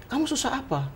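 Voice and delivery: soft, high-pitched, caring question; imitating concerned client. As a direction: ask gently, high and hushed, as a client. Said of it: "Dia bilang".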